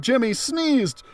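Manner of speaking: ordinary delivery, nothing marked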